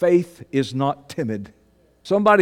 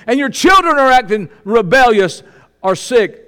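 full-force preaching; 9 semitones higher, 8 dB louder than usual. Acted. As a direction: belt it out to the congregation.

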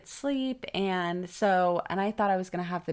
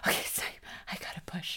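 whispered, retold urgency; reenacted breathy whisper to self; hushed realization; 6 dB quieter, faster than usual. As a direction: whisper it as if it just dawned on you.

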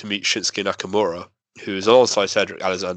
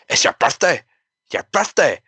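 higher-pitched, attempted Scottish woman's voice; bad Scottish accent; 7 semitones higher than usual. Wrestler surprised by fan's birthday, mock Scottish accent. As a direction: have a go at a Scottish woman, badly. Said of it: "I can't do a Scottish female accent".

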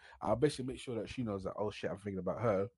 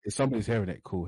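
ordinary talk

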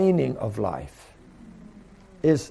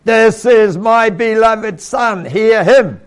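loud, slow, commanding decree; booming voice of God; 7 semitones higher, 11 dB louder, slower than usual. Retold or imitated. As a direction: thunder it down from heaven. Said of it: "And then God said".

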